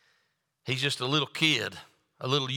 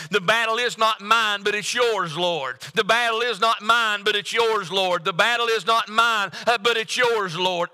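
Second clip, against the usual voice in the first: higher, earnest prayer, repeated like a chant; 6 semitones higher, 4 dB louder than usual. Shown as a demonstration. Preacher leads the congregation in prayer, higher and earnest. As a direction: pray it as a plea to the Lord. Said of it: "let's just turn that into a prayer".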